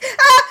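high, loud "ah!"; surprised yelp. Acted. Reader acting out the crush touching her hand, startled yelp.

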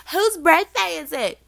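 put-on high, loud, drawn-out drunk-girl squeal; drunk party girl. Drunk girl coming up to the birthday girl, high squealed shout. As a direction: squeal it like a tipsy girl at a club. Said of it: "A lot of drunk girls coming up to me".

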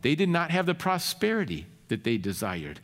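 usual speaking voice

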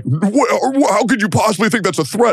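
high, quick, sputtering imitation; flustered mimicry. Streamer mimicking the critic denying a threat, flustered mimicry.

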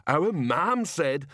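big simple man, anxiously holding to a rule; worried slow giant. A narrator's reading. Slow giant Banjo worried protest.

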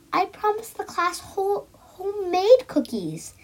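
high, slowed protest; upset little girl. A narrator's reading. Girl protesting to mother, high and upset. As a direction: insist the cookies must be homemade.

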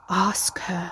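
breathless unvoiced whisper; a secret voice urging her on; eerie whisper. A narrator's reading. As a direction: ghostly whisper from the tile. Inner voice whispering to Carrie.

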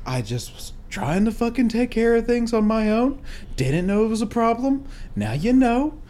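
smooth things over in short, careful phrases; staying very calm. Retold or imitated. Son apologizing to his angry dad to move past it, acted calm. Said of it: "I just stayed so calm".

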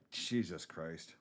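low, slow, soft retold dismay; dismayed reaction. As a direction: let it sink out slowly. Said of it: "And I'm like".

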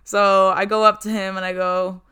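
ordinary delivery

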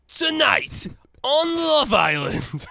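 high, hugely drawn-out TV voiceover; Ian Stirling. Acted. Impersonating a dating show narrator for friends, drawn-out announcer voice. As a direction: stretch it out like a reality show announcer. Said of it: "what's his face from Love Island".

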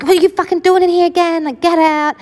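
very high, fast indignant demand; retold angry outburst. Child waking to find brother there again, retold angry outburst. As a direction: act out exasperated fury at an intruder.

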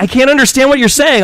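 high, loud imitation of a frustrated wife; mock exasperation. Voices wife complaining in retold argument.

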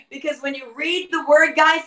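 ordinary delivery

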